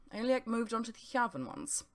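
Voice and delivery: usual speaking voice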